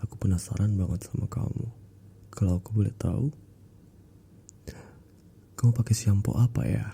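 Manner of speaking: a mild eerie hush; creepy spirit voice. Retold or imitated. Spirit in the receptionist quizzing the narrator, mildly eerie hush. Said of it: "ini dia ngomong kayak gitu".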